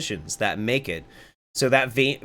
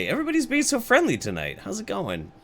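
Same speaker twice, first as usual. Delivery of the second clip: usual speaking voice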